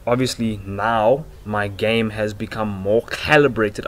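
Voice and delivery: ordinary talk, nothing marked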